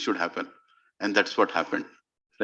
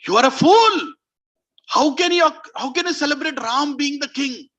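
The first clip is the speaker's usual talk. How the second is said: loud, high-pitched rebuke; scolding outrage; 9 semitones higher, 5 dB louder than usual. Acted. Mantra berates Kaikeyi for celebrating, outraged scolding. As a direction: berate her with raised voice.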